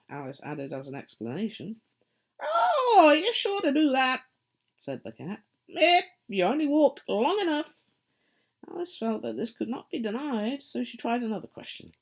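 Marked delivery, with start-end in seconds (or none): 2.4-7.7 high, loud, sly grinning cat voice; a teasing cat, pleased with itself; Cheshire Cat. Acted. The cat answering lost Alice, high and sly.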